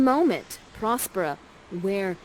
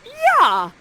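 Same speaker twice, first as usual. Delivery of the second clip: much higher, loud excited yeah; eager yeah; 9 dB louder than usual. Acted. Ferdinand accepting Miranda's proposal, loud excited yeah. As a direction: shout it like a thrilled boy. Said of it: "And Ferdinand is like".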